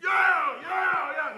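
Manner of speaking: high, whooping yeah; excited cheer. Retold or imitated. Celebrating in the retold story.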